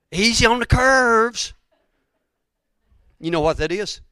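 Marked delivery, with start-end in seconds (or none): 0.1-1.5 loud, high, drawn-out caution; fussing protective shout; called-out warning. Retold or imitated. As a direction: holler it slowly to the driver. Calling out caution about the horses.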